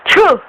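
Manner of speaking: loud, high pretend sneeze; mock sneeze. Mother tries to make baby smile, loud mock sneeze. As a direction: burst out a playful fake achoo. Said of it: "pagka-atching".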